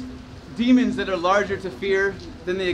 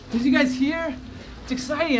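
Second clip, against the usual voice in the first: higher, bubbly good-news announcement; mock excitement; 7 semitones higher than usual. Debtor tells collectors he graduated, mock excited. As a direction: play up the big news.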